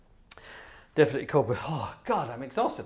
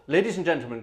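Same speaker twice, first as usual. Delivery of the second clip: normal talking voice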